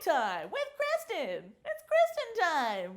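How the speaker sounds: high-pitched playful intro voice; silly high voice. Pretend. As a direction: play it like a cartoon kid announcing the show. Silly goofy show intro.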